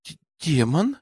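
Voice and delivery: hesitant stuttered question, rising at the end; unsure stammer. A narrator's reading. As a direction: squeeze it out, stumbling on the first sound. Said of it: "неуверенно заикаясь, выдавила Дина". (неуверенно, заикаясь)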